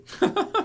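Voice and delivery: cracking up at the silly dub; burst of laughter. Real, not acted. Vlogger bursting out laughing at the dub.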